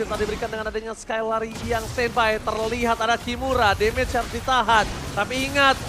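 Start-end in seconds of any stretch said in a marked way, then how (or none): none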